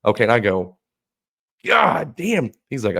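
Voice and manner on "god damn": loud, high exclamation; acted amazement. Friend seeing a cigar-sized joint, acted loud amazement. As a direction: burst out, amazed at the size. Reacts to sight of huge joint.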